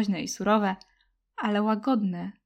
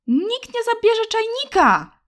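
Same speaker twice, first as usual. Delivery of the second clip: much higher, loud, slow tearful shout; girl shouting; 13 semitones higher, 7 dB louder than usual. Acted. Girl shouts at the boy to protect her dog, high and loud. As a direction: scream it out on the edge of tears. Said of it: "krzyknęła".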